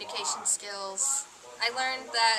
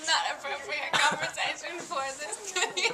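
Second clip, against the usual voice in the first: laughing; 3 semitones higher than usual. Real, not acted. Laughing, asks to stop filming.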